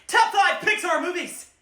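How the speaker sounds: high, loud hype intro; excited top-five shout; hype announcement. Acted. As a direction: announce it like a big show.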